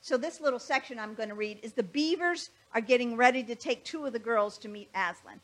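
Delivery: ordinary delivery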